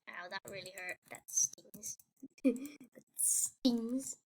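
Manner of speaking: soft whispered wince. Pretend. Gamer whispers after the character takes a hit. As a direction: breathe it out under the breath. Quiet after hurting in game.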